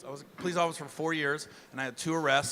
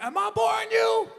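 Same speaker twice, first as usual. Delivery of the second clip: high, loud drill-sergeant bark; commander's shout; 5 dB louder than usual. Retold or imitated. Commander yelling at yawning recruit, loud barking shout. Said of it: "he's like".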